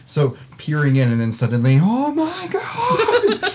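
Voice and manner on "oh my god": high, drawn-out laughing shout; startled shout. Real, not acted. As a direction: shriek it in sudden surprise. Sudden darkvision shock, laughing shout.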